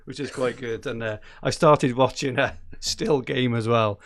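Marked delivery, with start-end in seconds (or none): none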